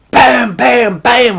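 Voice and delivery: high, loud shouted bam noises; mock gunshots. Russell firing the shotgun, shouting gunshot noises. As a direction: bark out each shot like a shotgun. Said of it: "And he goes".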